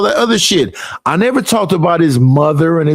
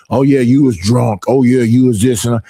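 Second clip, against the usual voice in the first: lower, dumb mimicry of critics; mocking low voice; 8 semitones lower than usual. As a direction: parody their whining, voice dropped low.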